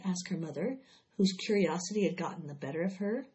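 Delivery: ordinary delivery, nothing marked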